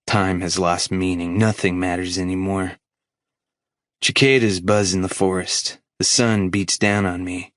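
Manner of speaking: ordinary delivery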